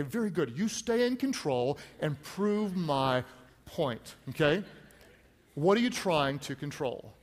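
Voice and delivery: ordinary talk, nothing marked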